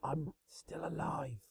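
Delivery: soft, slow whisper. A narrator's reading. Vanilla whispering from the floor, unheard. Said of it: "Vanilla whispered".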